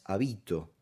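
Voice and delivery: "habito" stressed on the bi; stress on middle syllable. Shown as a demonstration. Teacher giving the second case, "habito" stressed on bi. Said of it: "La sílaba acentuada es vi".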